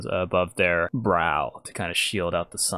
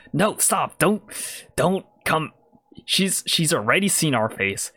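higher, halting urgent plea; urgent warning; 7 semitones higher than usual. Acted. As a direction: stop your accomplice in a hurry. Yuan-Ti halts approaching accomplice.

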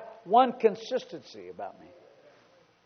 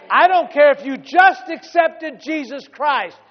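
preacher's shout; 4 semitones higher, 6 dB louder than usual. Acted. Sermon emphasis.

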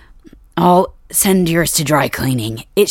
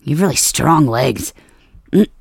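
ordinary delivery

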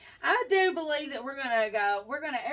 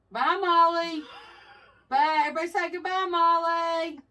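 calling out goodbye; 6 semitones higher, 7 dB louder than usual. Real, not acted.

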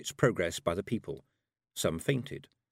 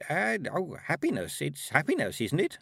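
put-on higher-pitched, uncertain old woman; old lady; 7 semitones higher than usual. A narrator's reading. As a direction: answer as a flustered old lady. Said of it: "said the old lady".

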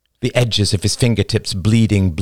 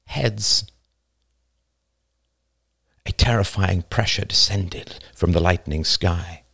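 usual speaking voice

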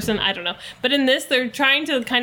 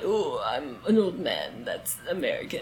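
put-on low, soft American old man; 8 semitones lower, 8 dB quieter than usual. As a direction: imitate an old man, pitched way down. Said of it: "he just had an old man voice".

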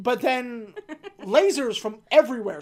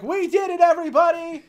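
high, loud celebration; rally the whole room; mock cheering; 9 semitones higher, 7 dB louder than usual. T cheers the agents, high and loud. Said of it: "and T is like".

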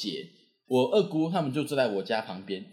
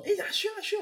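much higher calling-out voice; classmate calling. Retold or imitated. Classmate calling Xuan across the classroom, high calling out. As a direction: call his name across the classroom.

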